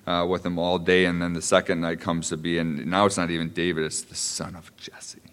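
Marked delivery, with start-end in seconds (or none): 3.8-5.2 hushed, pointed whisper; breathy aside as if sharing a secret. Acted. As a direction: drop to a loaded whisper on the name. Stressing Saul's cold naming.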